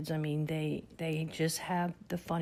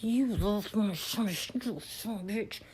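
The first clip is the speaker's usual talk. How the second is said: mock curse; 4 semitones higher than usual. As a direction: stretch the curse out in disbelief. Shocked by grandson's Santa question.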